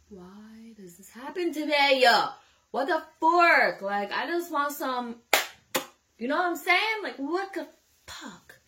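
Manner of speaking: high, loud, whiny exasperation; comic despair, voice up and loud; exasperated rant. Retold or imitated. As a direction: whine it out loud and fed up. Exasperated rant in her head.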